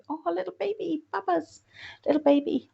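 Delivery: high-pitched gushing coo. Real, not acted. Host admiring a viewer's baby picture, cooing.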